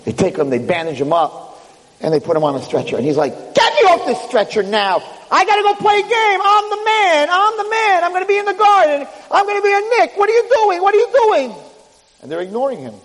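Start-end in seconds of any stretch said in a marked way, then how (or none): 3.5-11.5 high-pitched indignant yelling; frantic protest. Acted. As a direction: rant at the medics, voice pushed way up. Player protests on stretcher.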